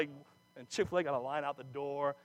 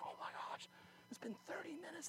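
whispered, rising higher on "thirty minutes"; panicked whisper; 9 semitones higher, 17 dB quieter than usual. Retold or imitated. New teacher whispering in panic during classroom silence. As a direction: hiss it under your breath in alarm.

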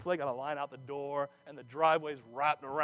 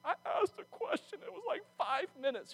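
indignant protest; 12 semitones higher than usual. Retold or imitated. Teacher protesting to observer.

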